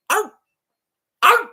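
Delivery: high yappy bark; yappy chihuahua voice. Retold or imitated. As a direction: yelp like a little dog. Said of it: "You sound like a chihuahua".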